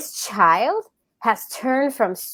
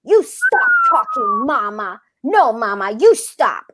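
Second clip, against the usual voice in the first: put-on high bratty toddler yell; imitating screaming toddler; 5 semitones higher, slower than usual. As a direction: mimic the kid yelling at mom. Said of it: "he screams it".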